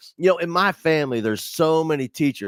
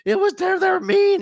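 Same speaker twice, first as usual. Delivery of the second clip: high, loud wailing complaint; hammed-up tearful whine to mom; mock wail of a miserable beginner; crying retold; 5 dB louder than usual. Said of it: "I'm crying".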